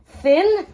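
thin said high and loud, rising; stress on thin. Shown as a demonstration. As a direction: punch the word thin up high. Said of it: "as thin as you can".

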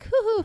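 high mock hoo-hoo chuckle; forced laugh. Reacting to a third teasing, high forced chuckle. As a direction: fake a thin, high laugh. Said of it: "Third time, like".

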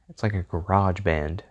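ordinary talk

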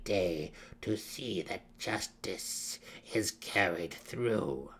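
put-on very slow, measured old matriarch; withered matron mother. A narrator's reading. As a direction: pronounce it slowly, with weighty authority. Matron mother pronounces judgement.

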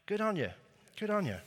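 Australian catchphrase. Acted. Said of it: "do it real loud".

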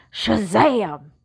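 loud comic-book battle cry; yelling Shazam. Acted. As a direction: fling it out like a superhero. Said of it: "Gladys yelled".

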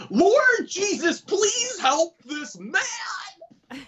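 very high, drawn-out plea to heaven; hammed-up prayer shout. Stranger praying over him at gas station, hammed-up cry. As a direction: imitate a fervent prayer, stretching every word. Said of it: "and he goes".

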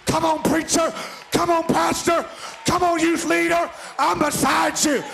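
rally the pastors; shouting encouragement from the pulpit; exhorting preacher. Acted.

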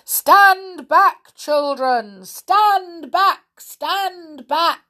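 big booming warning shout. Acted. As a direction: yell it to stop the children. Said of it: "big, deep voice from the jungle shouted".

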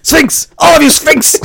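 very high mock battle cry; retold shouting. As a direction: holler it at fleeing victims, playing it for laughs. Co-host joins the retold shooting yells.

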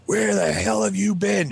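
high, drawn-out imitation of a dad's demand; angry dad. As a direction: confront the kid as an irate father. Retold angry dad at the door.

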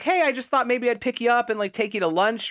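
mock a casual come-on; casual flirting. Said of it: "sort of flirting with her".

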